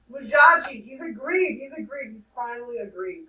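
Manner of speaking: loud, joyful calling out; granny cries out in joy; excited cry. A narrator's reading. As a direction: burst in with good news. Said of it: "Granny cried".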